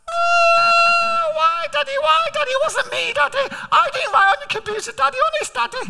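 put-on very high, childish whine, pleading innocence; squeaky little kid begging Daddy; a child's high plea of innocence; small boy whining. Retells his small son's whining denial.

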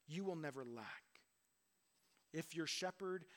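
normal talking voice

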